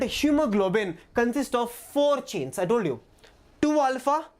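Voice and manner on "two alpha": two alpha lifted high; sing-song high rise on two alpha. Shown as a demonstration. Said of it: "rhythmic drill of chain counts".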